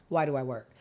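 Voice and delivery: usual speaking voice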